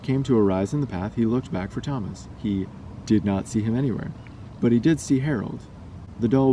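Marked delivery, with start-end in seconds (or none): none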